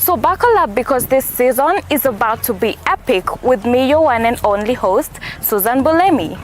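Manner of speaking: loud, bright host announcer read over music; punchy presenter hype; upbeat show promo. Acted. Recorded show promo outro.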